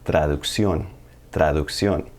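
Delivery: Spanish teacher model word; Spanish pronunciation. Shown as a demonstration. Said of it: "The Spanish word for translation is actually".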